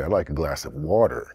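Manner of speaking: "water" in a Canadian accent, ending with a clear rolled-in R, "wah-ter". Shown as a demonstration. Showing how a Canadian orders water, Canadian accent with R. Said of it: "and a Canadian would say".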